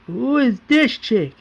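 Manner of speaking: high, slow drawn-out question; brash mock curiosity. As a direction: draw it out as an acted taunt. Merle sizing up a stranger.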